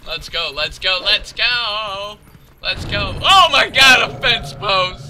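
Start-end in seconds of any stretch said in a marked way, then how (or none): 3.2-5.1 loud, higher exclamation; mock shock. Player runs into a fence post, loud mock shock.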